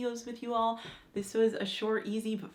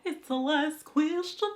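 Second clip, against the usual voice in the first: higher, louder and slow; loud announcement; 8 semitones higher, 5 dB louder, slower than usual. Shown as a demonstration. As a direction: call it out like a game show host. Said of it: "Last question, y'all".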